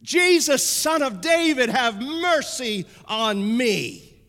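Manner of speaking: loud desperate cry, then slowing; a blind man shouting for mercy; yelling. Retold or imitated. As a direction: cry out to Jesus with all he has. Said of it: "the louder he yells".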